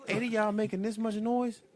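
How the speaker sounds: high, amazed disbelief. Real, not acted. Host marvelling at the small cast's big noise, amazed.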